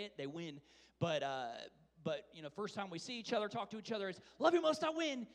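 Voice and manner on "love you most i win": loud, higher sing-song win; playful victory call. Retold or imitated. Dad showing the game with his little daughter, loud playful call. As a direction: fire it off first to win the game.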